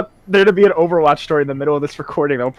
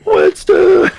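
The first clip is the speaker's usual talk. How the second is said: much higher, louder call; mock shout; 13 semitones higher, 5 dB louder than usual. Speaker reprising the joke, high loud call. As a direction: shout the name once more.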